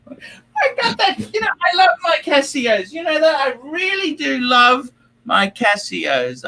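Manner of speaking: high, laugh-strained voice. Real, not acted. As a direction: talk through helpless high laughter. Laughing at Casio Royale joke.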